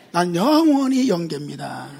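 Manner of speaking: slow, drawn-out joking boast; mock proud declaration. Preacher to congregation, slow mock boast. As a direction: playfully insist you're forever young.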